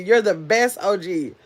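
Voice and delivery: ordinary delivery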